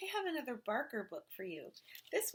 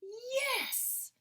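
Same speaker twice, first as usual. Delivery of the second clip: much higher joyful cry; excited shout. A narrator's reading. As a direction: yell it with glee. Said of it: "the twins shouted".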